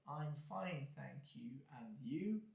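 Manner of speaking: reply with no change in tone; monotone. Shown as a demonstration.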